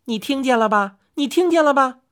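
overjoyed. A narrator's reading. Chang'e shows off her fame to her husband, overjoyed. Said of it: "嫦娥高兴得不知怎么才好".

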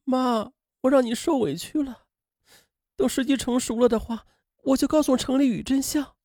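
soft, high-pitched tearful daughter; choked up. A narrator's reading. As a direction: apologise to mother, choked with feeling. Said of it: "潘明莹不仅哽咽". (哽咽着轻声说)

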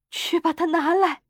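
high, breathless demand; panting urgency. A narrator's reading. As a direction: urge it out, worked up and high. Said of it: "他气喘吁吁地说".

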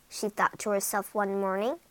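usual speaking voice

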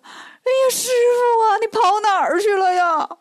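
high, loud comic wail. A narrator's reading. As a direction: Bajie bawls for his lost master. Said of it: "猪八戒就哭开了". (八戒拉长哭腔嚎啕大哭)

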